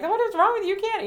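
high scolding mom voice. Retold or imitated. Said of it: "she just kept talking, like".

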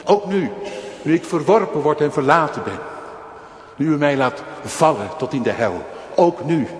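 luid en hoog preken, vol vuur; vurige preek. Acted. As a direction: preek het uit met volle overtuiging. Preekt met volle kracht. (vurig preken, luid en hoog)

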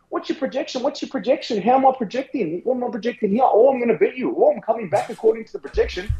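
high-pitched mocking imitation of nagging mates; mocking whiny voice. Mimicking mates nagging about projections.

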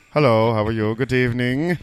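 put-on English Nigerian accent. Host imitates friend on request, mock English Nigerian accent. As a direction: put on a hammed-up formal English Nigerian greeting. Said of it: "He's an English Nigerian".

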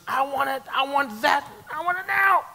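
put-on be a greedy toddler who wants it now; whiny demanding child. Believers panicking like a child, high whiny demand.

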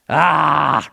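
a loud, open lion's roar. A narrator's reading. Aslan roars to the mice, loud and moved. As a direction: let it burst out like a roaring lion. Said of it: "roared Aslan".

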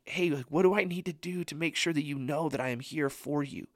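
soft, earnest reassurance; kind, pleading encouragement; gentle, not angry. Acted. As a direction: encourage tenderly, drawing out key words. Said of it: "isn't angry with us, but he's just saying".

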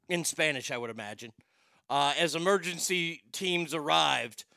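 ordinary talk, nothing marked

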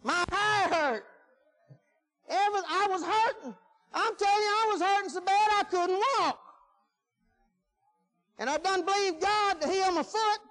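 high, insistent preacher's storytelling; animated preaching testimony. Acted. Preacher tells congregation how badly he hurt, animated testimony. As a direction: build the tale in a high, driving preacher's voice.

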